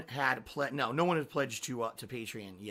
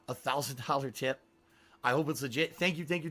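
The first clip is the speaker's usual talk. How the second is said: ordinary talk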